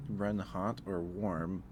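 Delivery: ordinary talk, nothing marked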